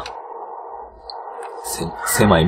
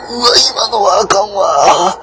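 dismayed groan; 12 semitones higher, 5 dB louder, slower than usual. Real, not acted. Near miss in game.

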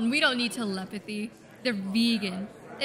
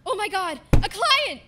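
excited; 8 semitones higher, 7 dB louder than usual. Acted. Detective excited by new client.

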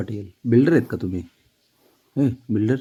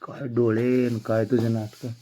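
normal talking voice

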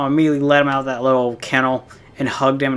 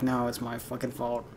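softer, tearful self-blame; 12 dB quieter than usual. Retold or imitated. Grieving owner blaming herself over her cat, softly tearful. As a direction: murmur it through tears, much softer. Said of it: "I was crying and hugging him".

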